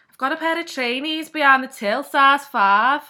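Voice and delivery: loud, high, broad Scouse shout; Scouse shop worker. Retold or imitated. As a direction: call across the shop in thick Scouse. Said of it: "I used to work in Sports Direct in Liverpool and be like".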